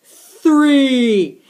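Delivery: slow count building to loud; building stern count. Acted. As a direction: count it out like a parent losing patience. Counting to punish.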